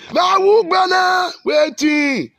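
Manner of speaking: high, slow, drawn-out shout; defiant shout. Real, not acted. Preacher rejects calls for silence with a slow, high shout. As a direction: fling the question out at full voice.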